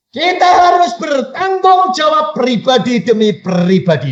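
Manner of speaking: high, forceful pulpit shout; preaching shout. Real, not acted. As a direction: drive it home at full volume. Preacher drives point home to congregation.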